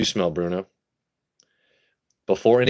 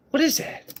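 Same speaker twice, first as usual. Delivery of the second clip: put-on much higher, squeaky baby-talk question; pet talk. Streamer coos at guinea pig Bruno, squeaky baby talk. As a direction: coo at the pet in a tiny voice.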